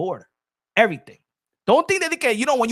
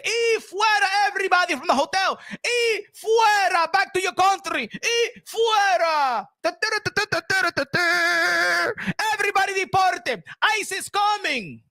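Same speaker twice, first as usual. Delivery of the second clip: high, slow shouted orders; mock deportation shout; 11 semitones higher than usual. Trump ordering everyone out of the hotel, shouted high. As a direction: yell them out of the hotel. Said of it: "Donald Trump le dijo a, a Chacá".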